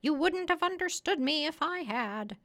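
high, loud parrot retort. Acted. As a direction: answer back in a high parrot voice.

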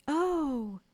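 high, loud drawn-out swoon; awed "oh". Acted. Boys hearing Wendy's reveal, awestruck. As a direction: exclaim in long wonder.

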